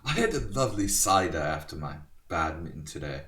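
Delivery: a clumsy put-on British accent. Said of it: "I should be banned from doing British accents".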